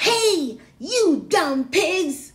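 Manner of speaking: loud, high, slow yell at the pigs. Acted. Megan yelling at the pigs in the pen, loud and slow. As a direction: shout it out, dragging each word. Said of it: "and yelled".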